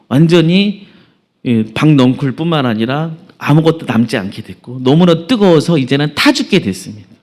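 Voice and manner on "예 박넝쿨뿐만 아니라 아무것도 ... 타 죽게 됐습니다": normal talking voice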